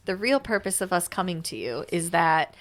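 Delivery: usual speaking voice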